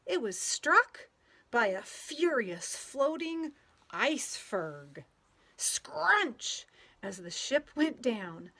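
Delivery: lively storybook read-aloud with playful sound words; reading to children. A narrator's reading. Teacher reading a picture book to children, lively and playful. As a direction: read it out to a group of kids, bouncing along.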